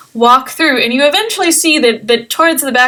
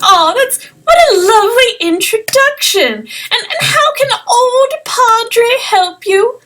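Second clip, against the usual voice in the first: put-on little old halfling; 10 semitones higher than usual. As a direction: savour his own name like a proud old man. Padre answers the introduction.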